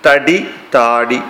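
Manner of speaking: slow contrast, തടി with a short a, then താടി with the first vowel held long; short versus long vowel. Shown as a demonstration. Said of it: "ഇപ്പൊ താടി എന്ന് പറയുമ്പോ അതിലൊരു ദീർഘമുണ്ട്". (തടി ചുരുക്കി, താടി നീട്ടി പതുക്കെ പറയുക)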